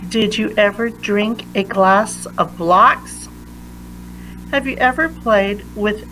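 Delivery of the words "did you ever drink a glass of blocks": plain reading voice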